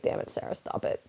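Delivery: soft, lightly rasped complaint; best friend Kevin, mildly fed up; a hint of an exasperated friend. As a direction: mutter it, fed up. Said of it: "he's just like".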